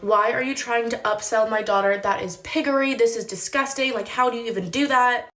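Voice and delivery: ordinary delivery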